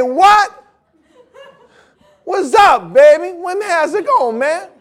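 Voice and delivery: high, loud put-on slang greeting; a loud put-on slang voice, mocking; mock street talk. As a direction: ham up a hip dude.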